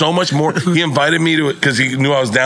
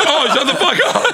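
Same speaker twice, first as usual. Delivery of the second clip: high, laughing disbelief; 13 semitones higher than usual. Retold or imitated. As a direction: gasp it out in amazement. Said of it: "And I'm like".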